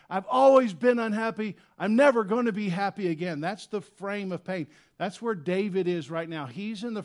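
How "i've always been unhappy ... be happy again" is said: high, loud wail of despair; loud, high anguished exaggeration; anguished outcry. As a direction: cry it out like the pain will never end. Voicing exaggerating sufferer.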